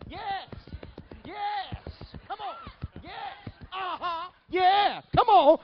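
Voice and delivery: very high, hammed-up urging in bursts; ecstatic cheering. Church mother urging on child speaking tongues, ecstatic. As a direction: squeal encouragement like an excited church mother.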